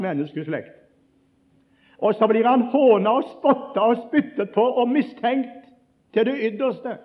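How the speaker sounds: high, loud, impassioned preaching; a sermon rising to full force; fiery preaching. Acted. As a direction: preach it out to the rafters.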